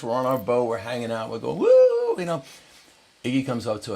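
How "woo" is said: high, loud celebratory "woo"; victory whoop. Retold or imitated. Crew celebrates winning the balloon raid, high whoop. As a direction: whoop it up in mock triumph.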